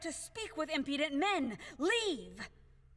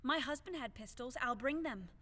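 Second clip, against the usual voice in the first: ordinary delivery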